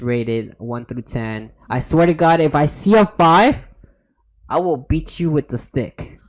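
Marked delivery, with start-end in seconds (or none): 1.7-6.2 mock threat. Playful threat to viewers.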